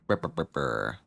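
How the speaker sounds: low soft lip-brr noises; lip trill. Real, not acted. Player waiting on dialogue, soft lip trill. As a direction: idly trill the lips while thinking.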